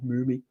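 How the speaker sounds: "Moomy" said softly with an oo as in moon; pronounced moomy. Shown as a demonstration. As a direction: say "Moomy" with a long oo, quietly. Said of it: "mummy is apparently pronounced moomy".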